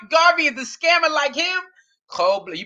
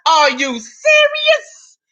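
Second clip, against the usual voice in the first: slow, stretched incredulous cry; are you serious, drawn out; mock disbelief; 3 semitones higher, 4 dB louder, much slower than usual. Real, not acted. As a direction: drag it out in disbelief. Catchphrase again.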